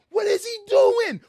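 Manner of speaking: high, loud frustrated yelling at a driver; exasperated shouting with laughter breaking in; road rage yell. Retold or imitated. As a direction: scream at the stalled car to move. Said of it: "I'm like".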